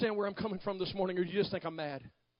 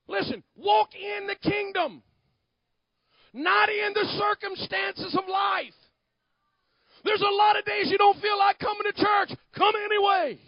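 loud, higher exhortation; fervent pulpit exhortation; preaching; 9 semitones higher, 9 dB louder than usual. Acted. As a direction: urge the church to come anyway.

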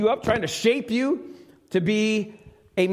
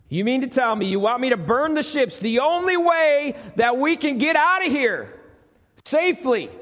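can't believe the order, pitch rising; an officer pleading that the ships are their only way home, higher and incredulous; incredulous protest; 6 semitones higher than usual. Acted. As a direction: voice climbing higher in disbelief.

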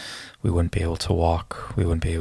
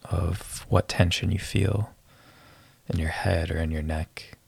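guided meditation. Acted. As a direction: ease them into relaxing.

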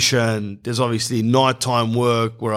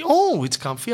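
mock saleswoman again; 9 semitones higher than usual. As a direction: repeat it high but easing off. Salesperson voiced, repeated take.

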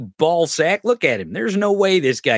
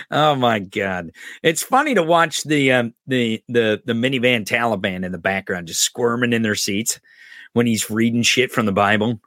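ordinary talk, nothing marked

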